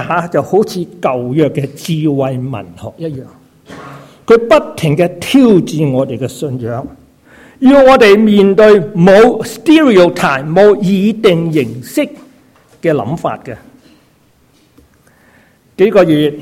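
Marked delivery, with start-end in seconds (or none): none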